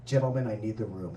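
low, brisk voice of mock authority; Steven Spielberg. Spielberg to the men in his office, mock-grave authority. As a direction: drop low and clear the room. Playing Spielberg clearing the room.

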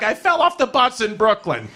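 a hint of a worked-up mother explaining herself; lightly voiced mother. Mother explains her bloody knee to son, lightly worked up.

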